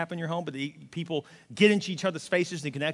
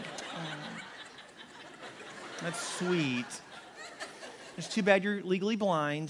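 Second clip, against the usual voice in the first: wife's sarcasm; 8 dB quieter, much slower than usual. Retold or imitated. Pastor voices wife's sarcastic reply.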